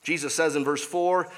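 ordinary talk

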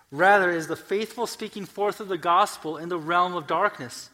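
loud, high, impassioned sermon climbing to a peak; full-force preaching. Real, not acted. Preacher declaring the devil's defeat to the congregation, full-force preaching.

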